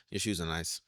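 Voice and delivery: low, soft compliment with no inflection; deadpan it, no smile; flat monotone compliment. Retold or imitated. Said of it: "no inflection on the voice".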